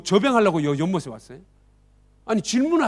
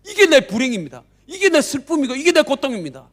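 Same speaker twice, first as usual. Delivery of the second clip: louder, higher, aggrieved outcry; resentful complaint; 3 semitones higher, 5 dB louder than usual. Acted. Sick man complaining to Jesus about others, loud and aggrieved. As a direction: pour out every grievance.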